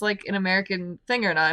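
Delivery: ordinary delivery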